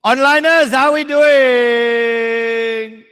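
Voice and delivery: loud drawn-out call. Real, not acted. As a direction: sing out the greeting to the online class. Calling out to online students.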